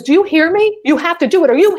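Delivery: loud, high, demanding mock yell; imitated yelling. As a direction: imitate an irate man yelling an order. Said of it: "And he's yelling".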